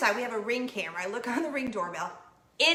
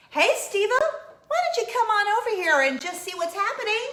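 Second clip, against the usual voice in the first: much higher and louder, hailing a neighbor down the street; calling out; 10 semitones higher, 6 dB louder than usual. Retold or imitated. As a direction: call across the street.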